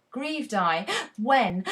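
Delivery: a sharp gasp breaks into the word "when". Shown as a demonstration. Teacher shows students how the commas force a sharp gasp. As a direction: catch your breath hard at each comma, then say "when". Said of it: "to take two sharp inhalations of breath".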